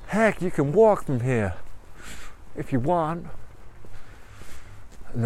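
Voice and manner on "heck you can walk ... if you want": higher-pitched American encouragement; motivational American tiger. Acted. American tiger telling runner he can walk, encouraging. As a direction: cheer them on in a put-on American voice. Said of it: "the tiger's a bit American".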